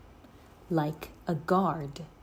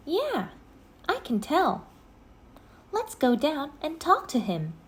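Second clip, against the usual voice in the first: put-on higher, eager girl voice; eager Annie; 9 semitones higher than usual. A narrator's reading. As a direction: Annie urges Jack to go talk to him, eager.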